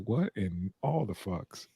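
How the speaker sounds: slow, soft, baffled mutter; low, drawn-out disbelief; quiet disbelief. Retold or imitated. Eyebrow-raise reaction quote.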